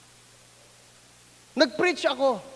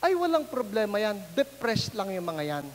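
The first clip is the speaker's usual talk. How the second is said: dismissive priest; 5 semitones lower, 5 dB quieter than usual. Retold or imitated. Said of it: "ang sabi niya".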